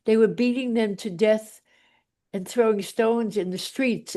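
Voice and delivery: ordinary talk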